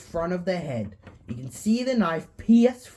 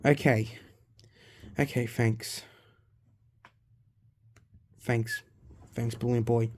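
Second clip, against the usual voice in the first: scared; 6 semitones lower, 4 dB quieter than usual. A narrator's reading. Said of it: "is scared".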